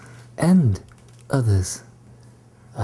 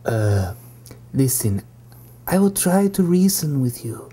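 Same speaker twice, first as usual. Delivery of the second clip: usual speaking voice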